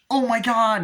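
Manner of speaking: high, loud over-the-top alarm; mock panicked cry. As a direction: cry out as if caught in a chase. Retelling a frantic chase.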